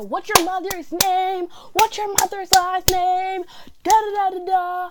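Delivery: high, loud, slow imitation of yelling; mock yelling teacher. Teacher writing in student's agenda, mock yelling. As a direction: yell like a furious teacher, hammed-up. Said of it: "she yelled at me".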